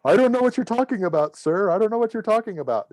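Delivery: mock-innocent denial, high and rushed; sheepish. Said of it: "and I sheepishly go".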